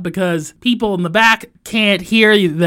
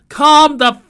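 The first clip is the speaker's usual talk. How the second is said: ordinary delivery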